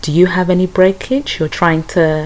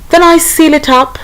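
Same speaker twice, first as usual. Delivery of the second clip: squeaky high voice; 12 semitones higher, 7 dB louder than usual. Pretend. Playful repeat of earlier line.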